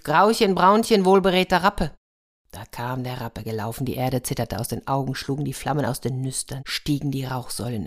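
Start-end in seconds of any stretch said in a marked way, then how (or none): 0.0-1.9 loud, raised summoning call across the field. Acted. Iwan summons his horse on the field, loud call. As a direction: call the magic horse out over the open field. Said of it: "und rief".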